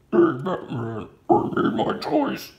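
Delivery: high, slow, airy monster voice; growl-whisper it like a clay monster taunting Batman; menacing Clayface villain calling out Batman. Acted. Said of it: "that's my Clayface voice".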